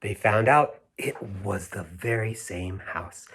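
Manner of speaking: gentle read-aloud storytelling; a storyteller reading The Little House to children; story narration. A narrator's reading. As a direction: read it like a bedtime picture book. Reads picture book aloud.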